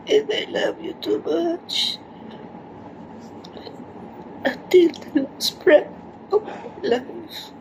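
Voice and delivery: sobbing whispered love; crying whisper. Real, not acted. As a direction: whisper through sobs.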